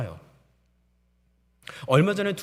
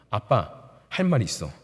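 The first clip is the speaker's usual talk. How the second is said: a hint of a lower, earnest small child; daughter's serious opening; 7 semitones lower than usual. Preacher voicing his four-year-old daughter starting her complaint, lightly and half-laughing. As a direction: lightly play the little girl with a laugh. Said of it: "따지는 거예요".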